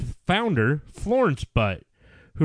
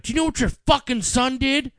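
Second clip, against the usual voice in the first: angry wife; 10 semitones higher than usual. Retold or imitated. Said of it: "she's like".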